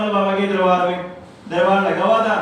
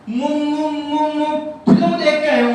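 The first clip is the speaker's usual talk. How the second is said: put-on stuttering boy; 5 semitones higher than usual. Boy tells the shopkeeper about the film, stammering high. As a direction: stammer up high and push out the words. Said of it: "तुतला के बोलता है".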